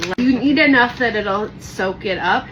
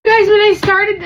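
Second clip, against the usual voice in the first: mock crying; 13 semitones higher, 10 dB louder than usual. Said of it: "I'm gonna cry right now".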